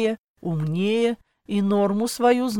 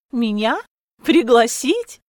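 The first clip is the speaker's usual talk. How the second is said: amused disbelief; 4 semitones higher, 5 dB louder than usual. A narrator's reading. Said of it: "Я засмеялась".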